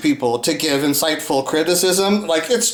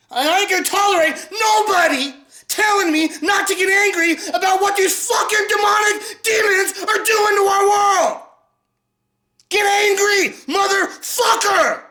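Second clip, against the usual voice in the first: high, cracking furious yell; enraged screaming; 12 semitones higher than usual. Real, not acted. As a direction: scream until the voice breaks. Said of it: "I'm fucking angry".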